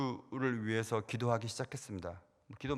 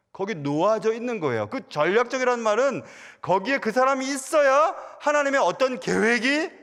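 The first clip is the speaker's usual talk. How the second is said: loud, high, driving sermon delivery; rising pulpit fervour, loud and high; full-force preaching; 12 semitones higher, 10 dB louder than usual. Acted. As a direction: preach it to the whole congregation at full volume.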